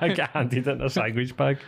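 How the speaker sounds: amused echo of his words; laughing repeat. Retold or imitated. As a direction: laugh through the line, echoing him. Mock repeat of friend's line.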